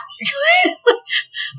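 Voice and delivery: squealing laugh high up; high laughing cry. Real, not acted. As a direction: burst into a shrieking laugh. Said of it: "big laugh".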